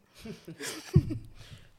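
short breathy laugh of disbelief; a dry, airless chuckle; bitter laugh. Real, not acted. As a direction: scoff out a wry laugh. Laughs at his "availability.

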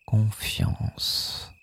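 whispered echo of Confiance; soft spa whisper. Shown as a demonstration. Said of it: "echo of dans la confiance".

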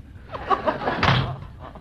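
high teasing laugh on exit; mocking laugh. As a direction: laugh at him as you go. Guest laughs while leaving.

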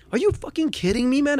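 high, exasperated ranting to himself; angry disbelief. Retold or imitated. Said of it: "I kept on saying that to myself".

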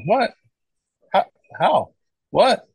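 high, baffled exclamations; retold disbelief. Retold reaction to having died.

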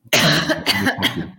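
sharp real cough; loud cough. Real, not acted. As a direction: cough hard once. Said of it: "je bois un verre d'eau".

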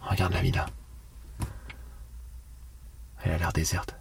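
quiet, hushed voice. Acted. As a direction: keep it low so no one hears. Sneaking near an empty villa.

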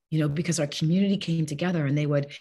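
ordinary talk

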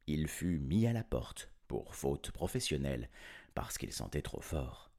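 usual speaking voice

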